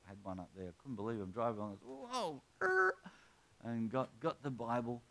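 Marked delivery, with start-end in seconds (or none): 2.1-2.9 high, loud screech to a halt; high-pitched skidding whoa; startled whoa, screech. Retold or imitated. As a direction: yelp "whoa" and imitate screeching brakes. Acts out braking for bookshop.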